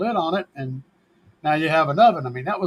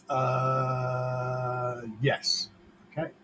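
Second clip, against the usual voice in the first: ordinary talk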